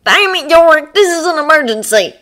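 loud, high exasperated protest; arguing. A narrator's reading. Man argues with the bear about his arm, exasperated. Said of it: "the man argued".

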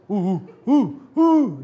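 slow, loud, high primitive grunting; exaggerated caveman grunts. Shown as a demonstration. Teacher demos the first human noises. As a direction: grunt like a cave dweller. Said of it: "Eran ruidos muy exagerados".

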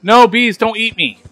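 high, loud hammed-up panic; mock panic. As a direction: pretend terror at the bees. Attacked by bees, mock plea.

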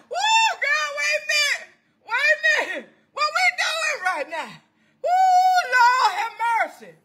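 very high, wailing church whoop; hammed-up holy panic, long high cries; acted overwhelmed outburst. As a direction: whoop as if caught off guard by the Spirit. Acts out being swept up speaking.

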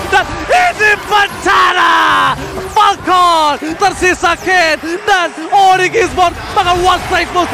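excited play-by-play. Acted. Final push call.

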